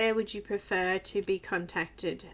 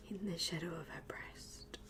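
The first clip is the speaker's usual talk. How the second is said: whispering; 4 semitones lower, 13 dB quieter than usual. Real, not acted. Repeats phrase whispered, laughing.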